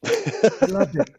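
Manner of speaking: high, slow mock Canadian accent. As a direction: put on a silly Canadian accent. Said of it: "Is that your Canadian accent".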